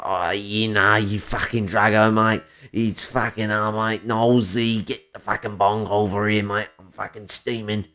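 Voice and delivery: stoned raspy Australian accent; put-on Australian accent, rough and raspy. Shown as a demonstration. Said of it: "I go for the raspy Australian".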